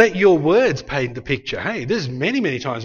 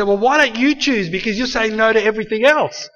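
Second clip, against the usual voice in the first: imitating an exasperated wife, higher pitched; mock frustration, pitched up as if fed up; frustrated wife; 5 semitones higher, 3 dB louder than usual. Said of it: "she would get frustrated and say".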